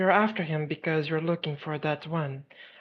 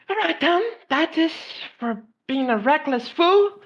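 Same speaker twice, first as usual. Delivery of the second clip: high, loud telling-off; scolding; 8 semitones higher, 4 dB louder than usual. Acted. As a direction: scold a reckless friend. Scolding him before punching.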